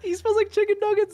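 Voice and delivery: high, loud jeering cry; teasing shout. Real, not acted. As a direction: call it out to mock him. Teasing protest at fake girlfriend.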